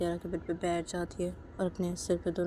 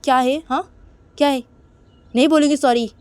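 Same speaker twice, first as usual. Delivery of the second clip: loud, raised defiant retort; irritated refusal; 6 semitones higher, 12 dB louder than usual. Acted. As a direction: snap back and refuse outright. निशा चिढ़कर इनकार करती है. (चिढ़कर ज़ोर से इनकार)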